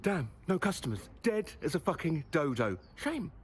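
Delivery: lightly voiced angry Scottish chef; a hint of a scolding Gordon Ramsay; Gordon Ramsay impression. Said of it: "something Ramsay would do".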